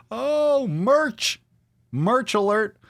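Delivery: high-pitched merch announcement; mock excitement. Host announcing merch to listeners, mock excited. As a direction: sing out the news in a high voice.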